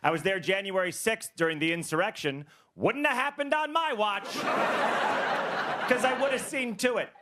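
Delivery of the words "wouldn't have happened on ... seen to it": high-pitched indignant boast; puffed-up comic bravado, pitched high; mock bravado. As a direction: boast as if you alone could have stopped it. Mock boast about the insurrection.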